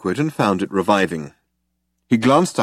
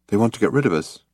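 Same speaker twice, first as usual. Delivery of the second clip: plain reading voice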